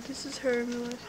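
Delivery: ordinary talk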